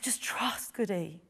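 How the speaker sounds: a soft whispered plea. Retold or imitated. Woman whispering to the weeping girl she hugs. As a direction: whisper it close, begging her to trust. Begging trafficked girl to trust.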